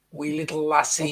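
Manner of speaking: lightly put-on Scottish; a touch of Scottish brogue; mock Scottish accent. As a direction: play at being a Scot. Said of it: "our Scottish accent".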